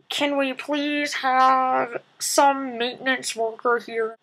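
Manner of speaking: slow announcement over a PA; loudspeaker call. Retold or imitated. Ride staff calling for maintenance over the PA, slow speaker voice. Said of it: "all of a sudden".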